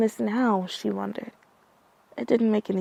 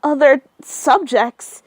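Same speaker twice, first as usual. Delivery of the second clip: loud, slow horrified echo; stunned loud question; shocked shout; 4 semitones higher, 10 dB louder, slower than usual. Acted. Horrified at the news.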